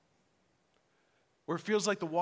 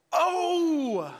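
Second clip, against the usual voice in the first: loud, high exclamation of alarm; shocked reaction; 9 semitones higher, 6 dB louder than usual. Retold or imitated. Doctor seeing the injured ankle, shocked cry. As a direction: imitate a doctor's aghast outburst. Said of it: "the doctor's first reaction when he saw my ankle".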